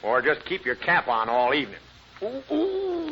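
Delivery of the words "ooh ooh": high pained ooh from tight boots. Acted. Husband groaning at his tight boots, high pained whimper.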